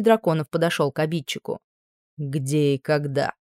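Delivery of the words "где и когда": slow, lower, chilling menace; menacing. A narrator's reading. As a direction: let each word raise goosebumps. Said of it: "От его голоса по коже поползли мурашки".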